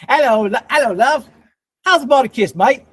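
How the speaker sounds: high, loud mock Australian accent. Shown as a demonstration. Streamer testing whether his accent can pass, playful Australian accent. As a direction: lay on a cheeky Australian accent. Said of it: "is my Australian accent passable".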